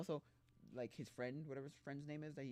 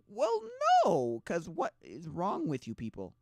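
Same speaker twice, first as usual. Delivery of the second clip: much higher and louder, snippy squeak; mock blunt reply; 5 semitones higher, 10 dB louder, much slower than usual. Townspeople answering the narrator, in a mock snippy squeak. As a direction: fire it back like it's obvious. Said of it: "And then they're just like".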